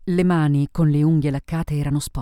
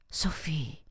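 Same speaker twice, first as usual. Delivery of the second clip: whispered name, barely voiced; hushed, disbelieving recognition; stunned murmur; 8 dB quieter than usual. A narrator's reading. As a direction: breathe the name out in quiet astonishment. Isabel stunned at seeing the drunken woman.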